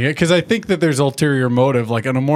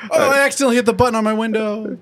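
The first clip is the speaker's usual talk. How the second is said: much higher and fast, fake-innocent; mock awkward excuse; 10 semitones higher, faster than usual.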